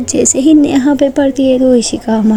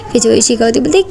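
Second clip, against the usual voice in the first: plain reading voice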